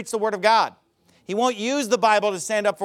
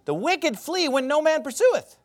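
"The wicked flee when no man pursueth" said again, pitched higher and rising to "pursueth"; raised repeat; 7 semitones higher than usual. Shown as a demonstration. Preacher repeats the verse to drive it home, pitched higher. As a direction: lift the repeat higher for emphasis. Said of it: "Proverbs twenty-eight, verse one".

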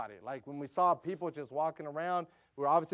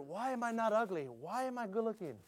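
put-on kids wondering; 5 semitones higher, 4 dB quieter than usual. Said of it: "if my kids ever wondered".

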